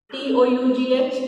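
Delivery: slow spelling of "L A U G H", each letter apart; spelled letter by letter. Shown as a demonstration. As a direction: spell it out slowly, one letter at a time. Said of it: "Now I am reading". (अक्षर-अक्षर धीरे से)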